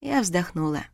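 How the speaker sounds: plain reading voice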